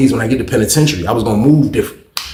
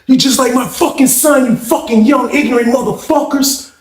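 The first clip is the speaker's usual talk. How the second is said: high-pitched imitation of a beaten man ranting; hammed-up high tirade; retold angry cursing; 10 semitones higher than usual. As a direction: act out a furious older man scolding. Imitates man on ground cursing him.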